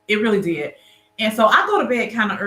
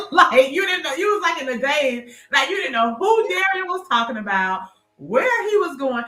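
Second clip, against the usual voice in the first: excited teasing; 7 semitones higher than usual. Real, not acted. Teasing her dazed look, laughing.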